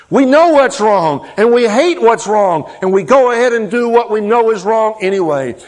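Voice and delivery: high, loud preaching climax; full-force preaching. Acted. Pastor preaching to his congregation, full force. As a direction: preach it out to the back row.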